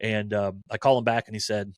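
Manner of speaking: usual speaking voice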